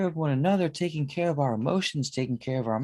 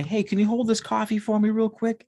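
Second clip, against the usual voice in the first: higher, casual stranger's request; stranger asking a favor; 8 semitones higher than usual. Retold or imitated. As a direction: lightly play a passerby asking a quick favor. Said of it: "The stranger would hand them coffee and say".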